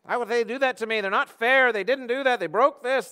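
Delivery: high, loud, aggrieved protest; indignado, queixoso. Acted. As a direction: complain loudly about how unfair it is. Acts out an aggrieved complaint.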